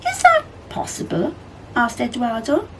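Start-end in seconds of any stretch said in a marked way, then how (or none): 0.1-1.3 character voice. A narrator's reading.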